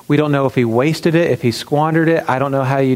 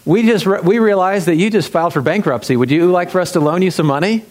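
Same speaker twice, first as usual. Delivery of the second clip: mock salesman; 5 semitones higher than usual. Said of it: "they're like".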